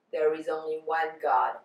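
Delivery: ordinary talk, nothing marked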